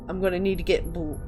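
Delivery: ordinary delivery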